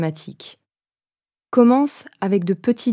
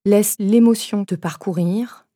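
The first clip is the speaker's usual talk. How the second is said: normal talking voice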